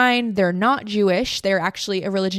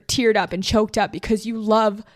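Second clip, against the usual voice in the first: ordinary talk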